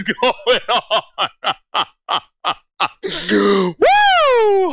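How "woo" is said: excited whoop. Real, not acted. Hype after a good meme.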